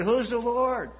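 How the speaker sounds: a haughty king scoffing at the question; mocking Pharaoh. Preacher mimics proud Pharaoh, high sneering voice. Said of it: "Pharaoh said".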